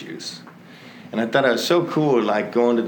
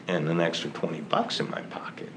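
soft unvoiced whisper, a bit fast; whispered aside; 6 semitones lower, 8 dB quieter, faster than usual. Real, not acted. Speaker telling the audience about saved money, whispering. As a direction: let the money point slip out in a whisper.

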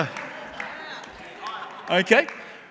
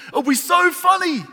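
gleeful shout; 8 semitones higher, 3 dB louder than usual. Retold or imitated. Child gloating over his trap.

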